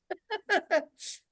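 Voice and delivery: high burst of real laughter. Real, not acted. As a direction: break into laughter at the irony. Laughs again at the story.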